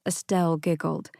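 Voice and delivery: ordinary delivery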